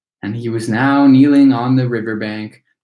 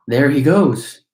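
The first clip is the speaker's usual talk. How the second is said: shout from crowd; 5 dB louder than usual. A narrator's reading. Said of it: "somebody shouted".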